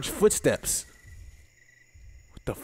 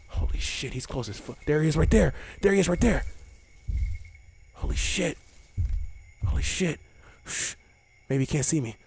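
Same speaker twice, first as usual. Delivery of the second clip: whispered softer alarm; hushed whisper; 4 semitones lower, 6 dB quieter than usual. Real, not acted. Player hiding near the T-Rex, frightened whisper. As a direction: breathe it quietly in fear.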